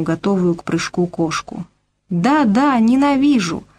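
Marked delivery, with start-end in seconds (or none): 2.1-3.6 angry outburst. A narrator's reading. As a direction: hurl the hatred at him. Angry girl repeats her hatred.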